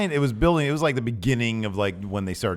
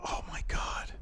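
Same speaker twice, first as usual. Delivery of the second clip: hushed amazed whisper; 10 dB quieter than usual. Real, not acted. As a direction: breathe it out in awe. Reacting to a fan question.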